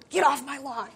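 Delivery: a hint of a cranky man shooing kids; lightly voiced grump yelling from the porch; grumpy old neighbor. Mildly voiced grumpy neighbor shooing people off his lawn. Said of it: "Mister Grumpy Gills".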